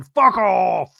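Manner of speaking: imitated emphatic send-off; Succession character impression. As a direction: bellow it like a TV drama character. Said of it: "Kinda like the dude from Succession".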